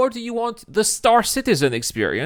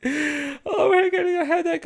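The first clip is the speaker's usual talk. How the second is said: laughing weakly; 10 semitones higher than usual. Real, not acted. Laughing so hard it hurts.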